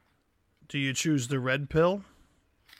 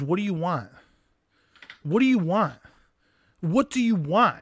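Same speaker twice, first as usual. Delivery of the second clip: mild mock yell of exasperation; imitated yelling; 3 semitones higher than usual. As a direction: act out a man demanding an answer, slightly raised. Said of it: "he's, like, just yelling at her".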